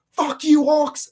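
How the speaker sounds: imitated angry shout, high and loud; pissed-off yell. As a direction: yell it like the cheated movie character. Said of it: "he's pissed, and he's like".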